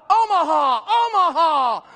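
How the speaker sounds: high, loud audible shouted at the line; Peyton Manning yelling. Retold or imitated. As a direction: bellow it like a quarterback calling an audible. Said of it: "And he yells what?".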